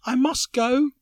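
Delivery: put-on high hammy Russian woman accent; ghostly Russian woman, high and accented. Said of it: "Oh, you nailed it".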